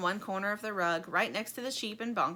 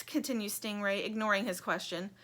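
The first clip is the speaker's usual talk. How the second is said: ordinary delivery, nothing marked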